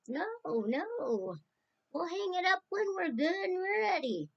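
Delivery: high-pitched playful protest; high mock defiance down the phone; retold defiant refusal. Girls refusing to hang up.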